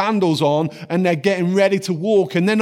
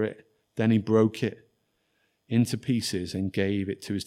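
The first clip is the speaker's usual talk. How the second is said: softer, reverent Bible reading; hushed scripture reading; 8 semitones lower, 7 dB quieter than usual. Acted. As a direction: let the words sink in quietly.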